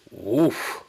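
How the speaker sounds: ordinary delivery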